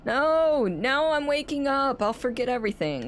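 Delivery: put-on high, whining little kid voice; whiny child voice. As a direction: whine it like a sulky child. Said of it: "in a voice that made him sound like a whiny child".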